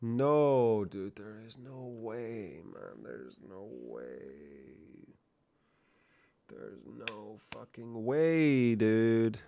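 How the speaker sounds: slow, stretched-out incredulous moan; drawn-out disbelief. Real, not acted. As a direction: draw out each "way" in disbelief. Disbelief at what was found.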